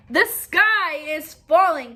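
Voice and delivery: slow, loud warning; crying out. Acted. The birds cry out to Cocky Locky, slow and loud. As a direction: drag out the alarm. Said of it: "cried Henny Penny".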